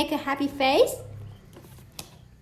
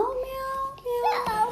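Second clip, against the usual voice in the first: high playful meowing like a cat; 12 semitones higher, 3 dB quieter than usual. Pretend. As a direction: mew it out like a kitten for a toddler. Mother imitates a cat's meow.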